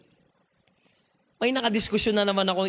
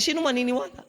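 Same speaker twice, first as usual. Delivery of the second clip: normal talking voice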